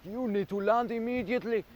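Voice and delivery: high-pitched imitation of French-accented orders; mock French accent. Retelling police ordering the drone down, mock French accent. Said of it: "In their really funny French accent".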